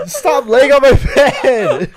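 slow, high wail of complaint; loud, stretched-out laughing protest; exasperated shout. Real, not acted. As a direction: shout it drawn out, half laughing. Banning sibling from the bed.

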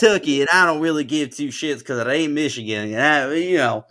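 exaggerated Southern twang; Texas or Kentucky accent. Co-host defending her accent to a doubter, Southern Texas-style accent. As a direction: keep up the put-on Texas accent. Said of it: "Texas, but it could be Kentucky".

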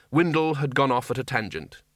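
usual speaking voice

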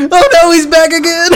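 high, laughing theatrical cries; hammy stage actor. Pretend. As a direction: overact a fleeing deity. Acting God hunted by priest.

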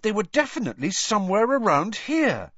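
put-on high, emphatic young hero's voice; insist, pitched well up; urgent insistence. A narrator's reading. Harry insists to Dumbledore they were here, urgent insistence.

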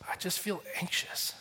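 breathy whisper, deflated; whispered anxious. Retold or imitated. Pastor mimicking himself absorbing a friend's anxiety, whispered. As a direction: let the worry leak out in a whisper.

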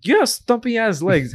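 high, drawn-out teasing exclamation; mock astonishment. Owner teasing a pet that jumps really high, mock astonishment. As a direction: exclaim it high and slow, poking fun.

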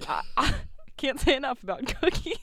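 high-pitched put-on southern twang, breaking into laughter. Shown as a demonstration. Podcaster struggling to keep it up, laughing southern twang. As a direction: ham up the twang, giggling. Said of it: "where's your southern twang".

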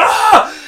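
very high short yelp; startled high yelp as he turns around. Retold or imitated. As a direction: jump in fright, high yelp. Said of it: "he's like".

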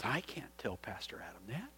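hushed, whispered objection; imitating a man scared to say it aloud; aghast whisper. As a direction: whisper it like a forbidden thought. Man aghast at confronting his pastor.

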